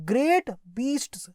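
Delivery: normal talking voice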